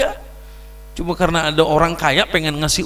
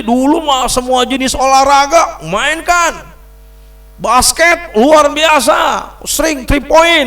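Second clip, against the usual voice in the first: boastful excitement; 6 semitones higher, 5 dB louder, slower than usual. Real, not acted. Boasting about past basketball.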